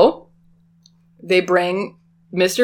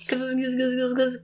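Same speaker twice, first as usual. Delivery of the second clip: buzzing taser noise; 6 semitones higher, 8 dB quieter than usual. Retold or imitated.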